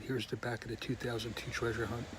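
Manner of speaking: ordinary talk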